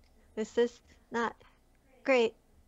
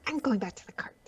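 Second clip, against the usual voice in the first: whispering; faster than usual. Acted. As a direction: whisper it firmly to yourself. Whispered decision.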